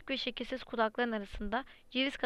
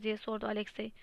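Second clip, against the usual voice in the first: usual speaking voice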